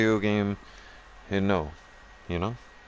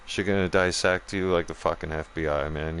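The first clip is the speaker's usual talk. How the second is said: usual speaking voice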